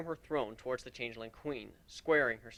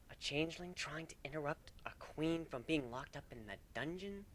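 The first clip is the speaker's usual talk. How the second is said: soft dry question; 3 semitones higher, 12 dB quieter than usual. Acted. Kuno voice, soft.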